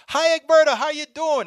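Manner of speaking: high, loud, sugary phone greeting; mock overfriendly caller. Company calls back months later, high fake-friendly greeting. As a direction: gush a fake-friendly hello.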